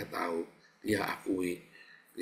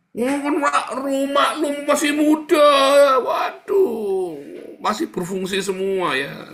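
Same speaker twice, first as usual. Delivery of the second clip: much higher, loud wailing plea; whiny excuse; 12 dB louder, slower than usual. Acted. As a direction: beg shrilly with a sob. Acted whining excuse, far voice.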